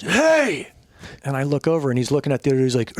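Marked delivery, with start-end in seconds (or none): 0.0-0.7 mock yell. Kurt yells at a stranger.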